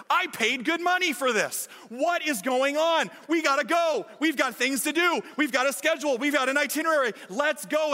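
a frustrated tourist pushing the guides to move; irate tourist. Retold or imitated. Irate tourist demands they leave.

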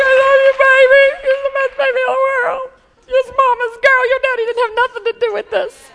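put-on loud, high, singsong baby-praising cry; hammed-up proud mama outburst; gushing mother losing it. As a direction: gush over your baby at full pitch. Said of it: "not just, like, lose it".

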